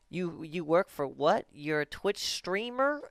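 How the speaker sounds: slow, drawn-out mock disbelief, rising at the end; baffled woman imitated. Therapist questioning the streamer about his job, slow imitated bafflement. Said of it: "this woman is just like".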